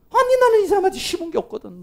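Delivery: high, loud protest of innocence; acted indignant denial. Person insisting they never wronged the other, high mock-indignant protest. Acts someone denying any blame.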